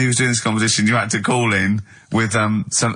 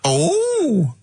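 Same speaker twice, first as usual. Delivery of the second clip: higher, excitable character greeting; Dirty Old Queer character; slower than usual. Pretend. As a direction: pop in as a silly comedy character. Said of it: "it's Dirty Old Queer".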